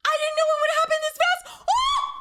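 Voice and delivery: high, loud, fast squeal of delight; excited shout. Real, not acted. Fan shouts at a surprise casting reveal, overjoyed. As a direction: blurt it out, overjoyed and disbelieving.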